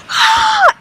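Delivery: very high imitated gasp of surprise; imitated delighted gasp. Register worker receiving flowers, imitated delighted gasp. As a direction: mimic her high, drawn-in "ah". Retold manager's reaction to flowers.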